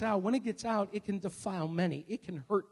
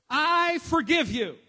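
I forgive you, slow and higher; slow earnest phrase; 9 semitones higher, 7 dB louder, slower than usual. Shown as a demonstration. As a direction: say it slowly like you mean it. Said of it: "Everybody say it with me".

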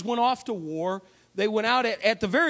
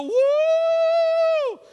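high, loud whoop; war cry whoop; 6 dB louder than usual. Retold or imitated. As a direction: let out a taunting war whoop. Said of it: "they come out and they go".

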